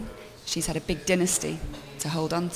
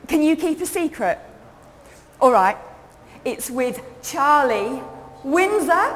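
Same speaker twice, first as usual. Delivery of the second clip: put-on high, loud, gossipy London girl; bubbly cockney girl spilling gossip; chatty young Londoner; 6 semitones higher, 5 dB louder than usual. Solo show character narration.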